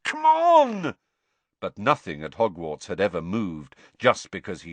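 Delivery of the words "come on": loud, high, drawn-out yell; high, urgent cry; frustrated shout. A narrator's reading. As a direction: shout with mounting impatience. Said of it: "Harry shouted at it".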